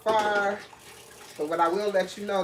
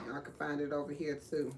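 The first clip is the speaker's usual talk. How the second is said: ordinary talk, nothing marked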